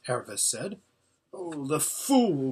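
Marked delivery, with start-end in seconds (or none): 1.3-2.5 slow, drawn-out groan of dismay. A narrator's reading. Hermit despairs of an untrained boy fighting, groaned dismay. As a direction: groan it out slowly. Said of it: "groaned the hermit".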